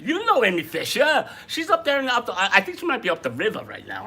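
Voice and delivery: put-on loud high Long Island accent. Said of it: "enough of the Long Island accent".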